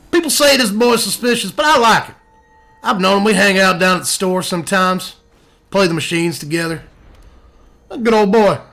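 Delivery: easygoing good old boy, lightly put on; country voice. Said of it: "This is my favorite of the country voices".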